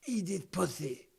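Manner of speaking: lisping Tyson impression; Mike Tyson voice. Shown as a demonstration. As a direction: say it with a Tyson lisp. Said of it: "I can't do a Mike Tyson impression".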